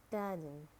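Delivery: "garden" with a British silent R; British "garden", said gah-dn; British accent. Shown as a demonstration. Teacher models garden for learners, British accent. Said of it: "in British English".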